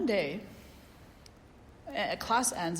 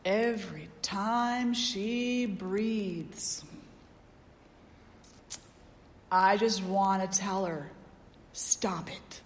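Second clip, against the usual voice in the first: irritated student; 3 dB louder, much slower than usual. Retold or imitated. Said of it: "and he goes".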